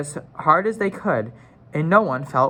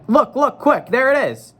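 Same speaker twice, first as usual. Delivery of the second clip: high, louder hurried look look; urgent excited call; 9 semitones higher, 4 dB louder than usual. A narrator's reading. Susan urges the others to see the beaver, excited high call. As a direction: point it out before it vanishes. Susan spots the hidden animal.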